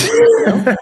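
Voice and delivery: high squeal of laughter; laughing. Real, not acted. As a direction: burst out laughing on it. Laughs at own rant.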